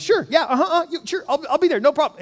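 high, over-eager people-pleaser agreeing; automatic yes. Acted. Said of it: "my automatic response is".

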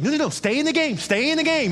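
high-pitched, insistent sideline order; urgent coach call. Retold or imitated. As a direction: imitate a coach urging a player on. Coach urgently calls to player.

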